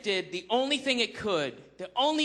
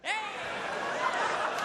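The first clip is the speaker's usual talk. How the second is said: mock call; 14 semitones higher than usual. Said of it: "I could have gone like".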